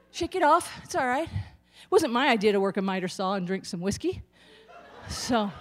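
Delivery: normal talking voice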